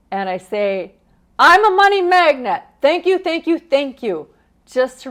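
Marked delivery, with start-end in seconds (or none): 1.4-4.3 loud, high, jubilant mantra; exuberant affirmation. Shown as a demonstration. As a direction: cry it out in celebration, easing off at the end. Re-enacts celebrating found money aloud.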